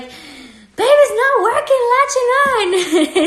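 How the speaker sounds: panicked dream self. Retold or imitated. Retelling a breastfeeding dream to viewers, high mock panic. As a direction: replay your flustered dream self, hammed-up. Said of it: "And I was like".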